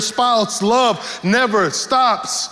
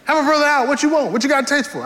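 higher, half-joking plea; pleading husband; 8 semitones higher, faster than usual. Retold or imitated. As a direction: beg her to help a brother out.

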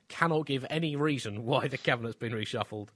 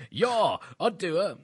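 louder, higher leering quip; a lewd leer pitched up and loud; mock Zuma leer; 6 semitones higher, 5 dB louder, much slower than usual. Comic impression of Zuma eyeing a woman, loud and high leer. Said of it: "Jacob Zuma says whenever he sees any woman".

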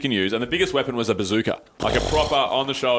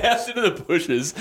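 laughing; 6 semitones higher than usual. Real, not acted. Laughing while telling.

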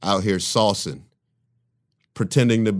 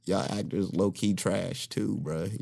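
muttered; 11 dB quieter, slower than usual. Retold or imitated. Retold muttered insult.

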